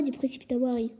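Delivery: usual speaking voice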